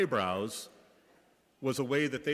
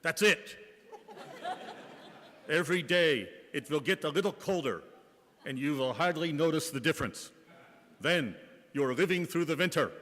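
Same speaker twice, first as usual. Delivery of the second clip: a hint of the old German nun giving advice; mild German-accented reassurance, slightly raised; Sister Scholastica's German accent; 3 semitones higher than usual. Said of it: "In her kind and gentle German accent".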